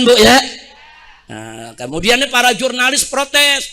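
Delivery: ordinary delivery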